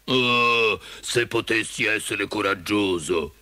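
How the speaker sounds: lento leone sconsolato; sospiro lacrimoso. A narrator's reading. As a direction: sospira il tuo desiderio con voce da leone. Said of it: "Si asciugò una lacrima con la punta della coda e sospirò". (leone piagnucoloso che sospira)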